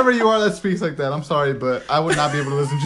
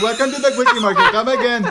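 higher, louder cartoon Indian accent; Apu's accent; 7 semitones higher, 5 dB louder than usual. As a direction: do a hammed-up Indian shopkeeper accent, laughing. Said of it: "You sound like a boo from the freaking census".

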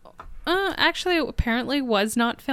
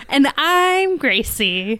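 slow, drawn-out showy announcer intro; imitating Gracie's intro; 3 semitones higher, slower than usual. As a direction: stretch the name like a host. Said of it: "you just say it in such a great way".

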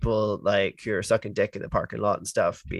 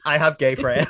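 mocking a defensive celebrity; 4 semitones higher, 6 dB louder than usual. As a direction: say it in a smug put-on voice.

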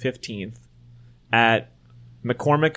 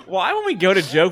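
usual speaking voice

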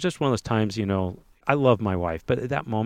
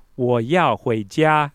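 terrible Chinese; 3 semitones higher, 4 dB louder, much slower than usual. Retold or imitated. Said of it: "in my terrible Chinese".

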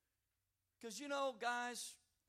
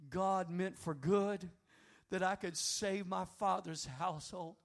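loud and slow, each word landing; ringing declaration; 10 dB louder than usual. Acted. Joseph declaring to his brothers that God meant it for good, loud and slow. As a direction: proclaim it with full weight.

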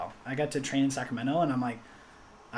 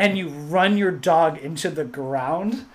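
slow, louder disbelieving question; incredulous; 3 semitones higher, 8 dB louder, much slower than usual. Retold or imitated. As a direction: stretch it out in mock disbelief. Incredulous retold challenge to runner.

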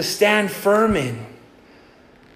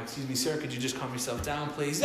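soft calming; 6 semitones lower, 10 dB quieter than usual. Retold or imitated. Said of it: "And I do my job".

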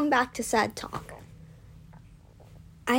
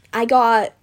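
ordinary delivery

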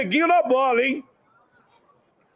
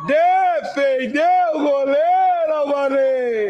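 excited shout; 3 semitones higher, slower than usual. Acted. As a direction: yell the save like a TV narrator. Shot saved, commentator shouts.

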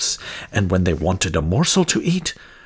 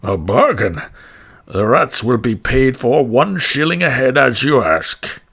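louder, hearty official deal; councillor agreeing; 3 semitones higher, 6 dB louder than usual. A narrator's reading. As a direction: seal the bargain with a show of heartiness. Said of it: "said to the stranger".

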